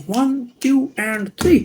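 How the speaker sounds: slow, drawn-out count to three; slow magic countdown. Pretend. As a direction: count it out slowly for suspense. (धीमी जादुई गिनती)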